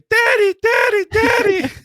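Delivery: put-on high child's shout, calling daddy; kid calling out. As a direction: shout high like a small child waking a parent. Voicing his kids waking him.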